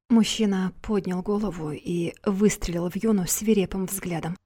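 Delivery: ordinary delivery, nothing marked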